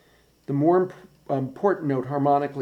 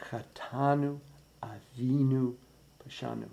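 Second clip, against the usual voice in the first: Chetanu, Avinu, Pashanu softer, no syllable standing out, fading away at the end; nothing sticking out; 6 dB quieter than usual. Shown as a demonstration. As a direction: keep every first note soft and even. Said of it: "None of those first notes should stick out".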